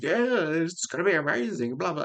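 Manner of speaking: hammed-up high-pitched hype; mock excited. Imitating everybody hyped for the film, mock excited. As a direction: imitate gushing fans, pitched right up. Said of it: "and they're excited".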